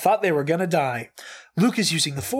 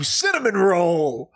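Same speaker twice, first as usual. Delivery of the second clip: shouted out; slower than usual. Acted. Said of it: "for you to shout".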